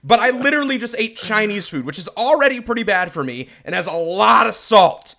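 mock offended rant. Real, not acted. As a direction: rant about being insulted. Offended by cake fortune.

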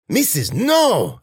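high drawn-out wail of objection; alarmed protest. Acted. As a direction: plead with the mistress in dismay.

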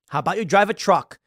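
normal talking voice

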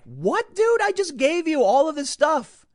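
cracking high voice of outraged disbelief; indignant disbelief. Retold or imitated. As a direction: act out outraged disbelief at the ungrateful guy. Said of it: "And I was like".

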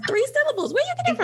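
very high, incredulous squeal; mock disbelief. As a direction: exclaim in playful amazement at a child. Astonished at toddler's vocabulary.

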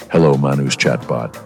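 usual speaking voice

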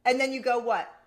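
usual speaking voice

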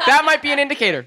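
high laughing tease. Real, not acted. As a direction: giggle it out.

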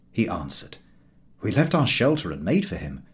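ordinary delivery

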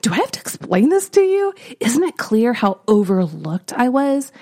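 mock-indignant talking back to God; half-playful sassy comeback. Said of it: "I was really kinda sassy".

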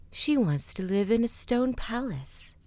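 usual speaking voice

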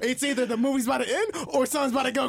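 high-pitched building anticipation; retold suspense. Man reliving the movie's ending, excited suspense. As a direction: act out a viewer realizing something is coming. Acting out suspense before cliffhanger.

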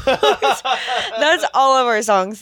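laughing it out, slowed; said through a laugh. Real, not acted. Laughing repeat of own line.

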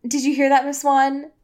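usual speaking voice

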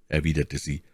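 ordinary delivery, nothing marked

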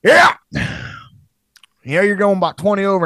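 loud, high, fast mock Southern trooper; thick trooper drawl. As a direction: lay on a thick Southern accent, hammed up. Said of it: "they lay it on a little thicker".

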